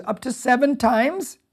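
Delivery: slow, high hopeful question; Peter asking. Retold or imitated. Peter offering Jesus a generous number, hopeful. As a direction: stretch seven out as if proud of it. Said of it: "And Peter goes".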